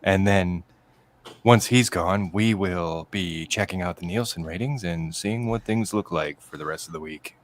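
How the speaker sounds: very low, inflection-free monotone; deadpan announcer monotone; Chad impression. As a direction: drone on low and deadpan like a robotic host. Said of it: "I'll do it".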